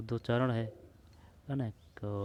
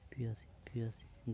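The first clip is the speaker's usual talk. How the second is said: whisper; 9 dB quieter than usual. Real, not acted. Whispering answer to study partner.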